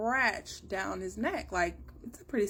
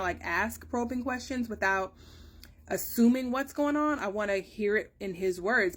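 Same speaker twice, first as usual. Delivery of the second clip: usual speaking voice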